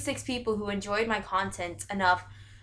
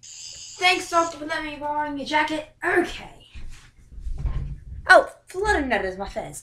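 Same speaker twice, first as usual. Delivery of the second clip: playful put-on British accent, higher pitched; fake British accent; 5 semitones higher than usual. Fan thanking the Doctor for the jacket, fake British accent. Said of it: "this fake British accent".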